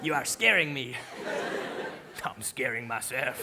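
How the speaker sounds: subdued mock-French confession; French accent. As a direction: drop into an uneasy French-accented admission. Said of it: "with a slight French accent".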